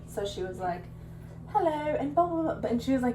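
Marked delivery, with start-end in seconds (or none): 1.5-2.7 higher-pitched mock Australian chatter; imitated Australian accent. Retelling an Australian lady at a coffee shop, imitated Australian accent. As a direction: put on an Australian woman's accent. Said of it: "in her Australian accent".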